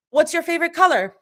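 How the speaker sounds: high, fast, eager blurt. Acted. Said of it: "blurt out at the same time".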